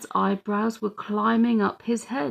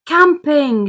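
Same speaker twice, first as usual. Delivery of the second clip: excited yell, much higher and louder; 7 semitones higher, 9 dB louder than usual. A narrator's reading. Little girl yelling at Mum while waving her carrot. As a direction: shout it out with glee. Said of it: "she yelled".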